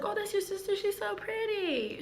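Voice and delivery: high, sweet gushing voice; mock-sweet fawning over the sister; gushing compliment. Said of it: "I was like".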